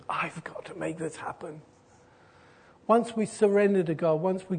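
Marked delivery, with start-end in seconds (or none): 0.1-1.6 breathy whisper, much softer; hushed whisper of someone trying to stay in control; whispered inner voice. Retold or imitated. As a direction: whisper it like a private anxious thought. Voicing an anxious controlling attitude.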